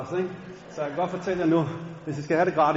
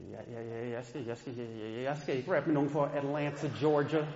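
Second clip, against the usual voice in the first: much lower, soft, stammering; nervous mumble; 4 semitones lower, 7 dB quieter than usual. Retold or imitated. Scared boy refusing his friend, low stammering mumble. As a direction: shrink away from the idea.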